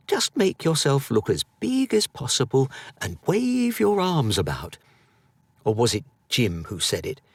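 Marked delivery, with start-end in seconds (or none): 0.1-4.8 mate's advice. Retold or imitated. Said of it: "Ben saying something like".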